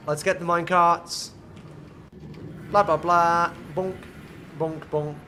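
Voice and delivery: normal talking voice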